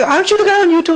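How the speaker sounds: high, mock-eager fan voice; imitating an excited fan. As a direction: play the starstruck fan. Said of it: "It's like".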